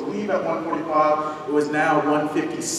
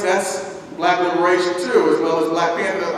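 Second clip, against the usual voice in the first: ordinary delivery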